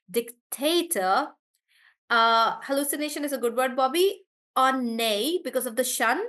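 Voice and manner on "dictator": dictator drawn out, TA stressed. Shown as a demonstration. Teacher repeating dictator slowly, middle syllable stressed. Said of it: "on te".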